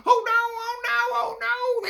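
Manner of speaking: very high, shrill cries of panic; panicking cats. Acted. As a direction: cats shouting in alarm. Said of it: "they shouted".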